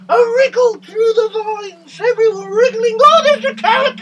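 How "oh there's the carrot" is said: higher, louder, quick excited cry; presenter thrilled at the carrot; excited find. Pretend. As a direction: spot the carrot with a gleeful shout.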